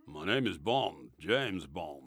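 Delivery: low, slow and soft secret agent; hushed low film-hero introduction; James Bond catchphrase. Acted. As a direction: deliver it deep and unhurried, like a suave spy.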